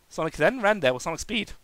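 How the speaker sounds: ordinary delivery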